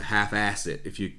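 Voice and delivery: half-ass it in a lightly faked American accent; a quick, terrible stab at American for half-ass it; bad American accent. Quoting McConaughey's dad's advice in a bad American accent. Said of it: "That was a terrible American accent".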